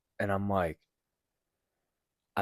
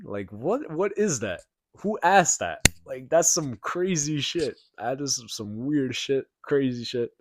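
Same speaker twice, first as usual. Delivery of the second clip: high, louder, incredulous outburst; real disbelief; 6 semitones higher, 6 dB louder, slower than usual. Real, not acted. Telling viewers about the bizarre question, incredulous. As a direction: pitch it up, baffled and appalled. Disbelief at being asked to trade bodies.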